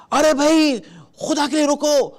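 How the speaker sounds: alarmed begging cry; panicked plea. Shown as a demonstration. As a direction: plead as if scared for him. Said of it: "آپ خود اتنا گھبرا گئے، اتنا ڈر گئے".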